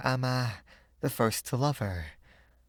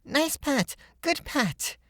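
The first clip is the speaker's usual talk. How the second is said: put-on high, thrilled little girl's cry; delighted cry; slower than usual. A narrator's reading. Little girl clinging to her old friend, crying out high and delighted. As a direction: squeal it with delight.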